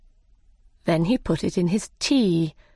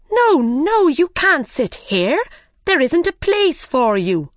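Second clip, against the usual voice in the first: loud, high cry of refusal; 9 semitones higher, 4 dB louder than usual. Acted. Hatter and Hare shouting at Alice, high and loud. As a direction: shout them away from the table. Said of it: "they cried".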